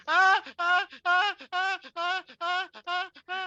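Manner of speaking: high-pitched squeals of alarm; panicked high squeaks; squealing panic. Acted. Sundae man squealing as the bear slams in.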